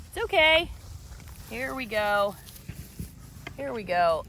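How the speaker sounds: soothing goat. Real, not acted.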